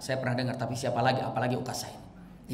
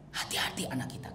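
hushed warning; 4 semitones lower, 10 dB quieter than usual. Shown as a demonstration. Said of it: "repeated warning, unvoiced".

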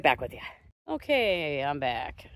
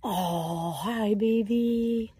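a slow, soft coo; 7 dB quieter, much slower than usual. Real, not acted. Cooing softly to her koi as if they were babies.

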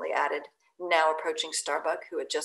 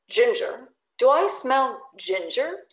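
higher, louder suspicious sniffing query; 5 semitones higher, 5 dB louder, slower than usual. Acted. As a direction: sniff out the ginger with distrust. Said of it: "suspiciously asked Stubb".

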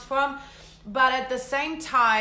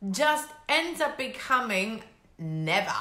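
normal talking voice

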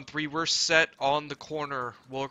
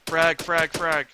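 urgent shouted warning, louder; grenade warning yell; 3 semitones higher, 6 dB louder, slower than usual. Real, not acted. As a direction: bark it out over gunfire. Grenade thrown in firefight.

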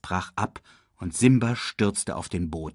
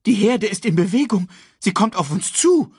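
high, louder bird warning; 10 semitones higher, 5 dB louder than usual. A narrator's reading. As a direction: warn the king, high and urgent. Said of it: "er deutete mit einem Flügel". (hohe Vogelstimme, warnend)

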